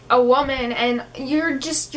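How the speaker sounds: ordinary talk, nothing marked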